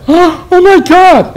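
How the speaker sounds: very high, loud, drawn-out mock gasp of delight; gushing amazed American. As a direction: squeal it like a starstruck American, hammed up. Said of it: "they're gonna like".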